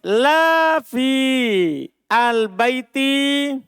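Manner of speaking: very slow, spaced Arabic reading; deliberate demo reading of la fil baiti rojul; slow example. Shown as a demonstration. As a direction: stretch each word for the class. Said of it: "begini contohnya".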